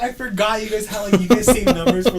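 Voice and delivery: high, loud retold excuse; mock embarrassed panic. Caught prank-ordering by coworker, flustered and loud.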